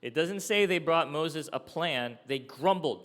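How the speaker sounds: ordinary talk, nothing marked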